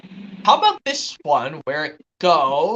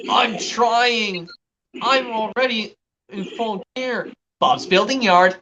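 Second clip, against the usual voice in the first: higher-pitched children's cartoon voices; Bob the Builder impressions; 4 semitones higher, slower than usual. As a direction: play out a kids' cartoon scene, pitched up. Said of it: "from season one of Bob the Builder".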